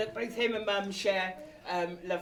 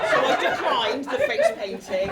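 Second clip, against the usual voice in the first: higher, louder, laughing through it; 13 semitones higher, 9 dB louder, slower than usual. Real, not acted. Telling guests about mum's offer, laughing. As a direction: crack up at your own story.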